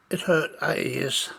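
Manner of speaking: usual speaking voice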